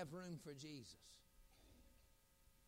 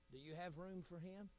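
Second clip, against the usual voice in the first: normal talking voice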